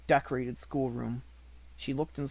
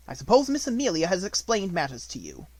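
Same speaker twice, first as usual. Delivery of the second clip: louder, harsh headmistress voice; domineering schoolmistress, louder; stern Miss Minchin; 6 semitones higher, 5 dB louder than usual. A narrator's reading. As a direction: order it coldly.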